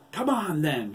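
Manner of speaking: high, loud challenge; exclaiming. A narrator's reading. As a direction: shout it, daring them to attack. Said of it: "she exclaimed".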